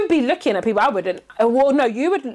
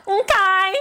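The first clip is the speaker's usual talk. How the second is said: mock high okay; 8 semitones higher than usual. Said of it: "I was like".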